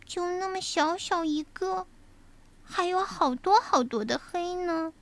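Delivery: high, small scared cub voice; little bear cub voice. Acted. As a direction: sound like a frightened little cub. (小熊胆怯的童声)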